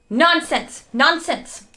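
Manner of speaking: growling. A narrator's reading. Father rejecting the fear that Stuart is dead, angry growl. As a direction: dismiss the idea sharply, twice. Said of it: "growled Mister Little".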